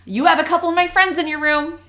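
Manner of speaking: high, loud imitation of a breezy colleague; EL teacher. Language teacher popping into a colleague's classroom, high and loud. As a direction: sing it out high as a teacher dropping in. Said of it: "came in and goes".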